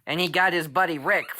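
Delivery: plain reading voice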